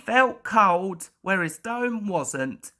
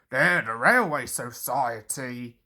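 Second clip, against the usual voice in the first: lower, steady engine driver; 8 semitones lower than usual. A narrator's reading. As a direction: read it as the kindly driver. Driver's voice in story.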